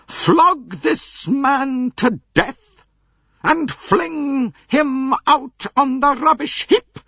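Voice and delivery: loud, slow, high order to servants; old princess ordering a flogging; imperious command. Acted. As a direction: bark it like a grand lady to her servants. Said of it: "I should have liked to call to my servants".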